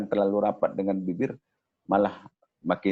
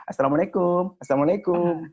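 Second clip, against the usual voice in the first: much higher, sing-song calling greeting; PKK lady visiting; 10 semitones higher than usual. Shown as a demonstration. A PKK lady calling at a friend's house for arisan, high and sing-song. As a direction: call it out at the door like a neighbourhood lady. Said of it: "Ibu P K K salamnya lebih beda lagi".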